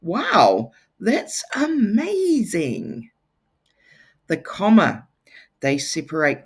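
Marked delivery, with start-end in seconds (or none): 0.0-3.1 slow, high amazed wow; strong feeling. Shown as a demonstration. Teacher demonstrating an exclamation with wonder. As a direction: gush over it, wide-eyed. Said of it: "shows strong feelings".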